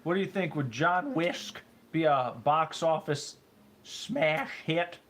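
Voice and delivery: put-on high, slow old man voice.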